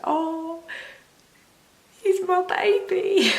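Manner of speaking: high, slow, tearful voice breaking; tearful high cry. Retold or imitated. New mum to her newborn, tearful and high. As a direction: relive the teary new-mum moment. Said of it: "I just cried my eyes out".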